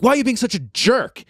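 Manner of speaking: half-hearted impression of a snapping mother; imitating the mom at security scolding her son, mock annoyed; mock angry mom. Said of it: "she's like".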